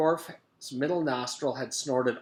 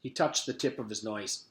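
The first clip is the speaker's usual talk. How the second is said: ordinary delivery, nothing marked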